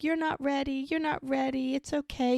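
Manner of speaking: high-pitched coaxing, imitating a man with a baby; baby talk. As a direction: distract a fussy baby in a singsong voice. Retold man soothing baby in chair.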